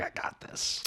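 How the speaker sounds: whispered smug. Retold or imitated. Voicing overconfident Travolta.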